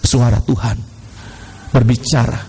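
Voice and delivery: low, measured, spaced-out phrases; calm. Real, not acted. Preacher showing calm prayer after panic, low and calm. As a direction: settle the voice down, pausing between phrases.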